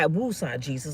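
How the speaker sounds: low, soft woosah breath; talking herself down; calming prayer. Retold or imitated. Praying to calm herself after getting mad, low and soft. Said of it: "I had to pray".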